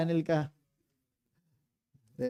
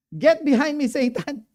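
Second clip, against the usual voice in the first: much louder, higher commanding rebuke; stern rebuke; 8 semitones higher, 10 dB louder than usual. Retold or imitated. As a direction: bark the rebuke at Peter. Jesus rebukes Peter.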